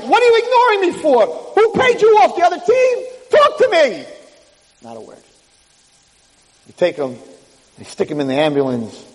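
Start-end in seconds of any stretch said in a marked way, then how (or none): 0.0-4.1 high, outraged yelling; indignant demand. Acted. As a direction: demand an answer from people ignoring you. Ignored player demands answers.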